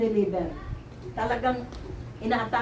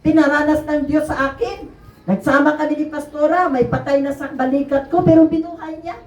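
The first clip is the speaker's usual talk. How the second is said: preaching; 5 semitones higher, 5 dB louder than usual. Acted. Testifying to the congregation, preaching at full force.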